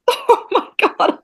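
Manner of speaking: breathless laughing, high and slow; cracking up in dismay; laughing disbelief. Retold or imitated. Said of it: "And I was like".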